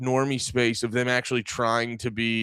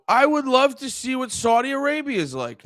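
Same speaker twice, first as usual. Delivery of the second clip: bright, much higher mock-eager wish; mock eager tourist; 12 semitones higher than usual. Podcaster mocking a celebrity's forced praise, eager high voice. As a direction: gush like a starstruck visitor, well up in pitch. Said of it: "I go".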